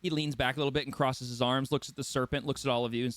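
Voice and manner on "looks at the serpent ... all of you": ordinary talk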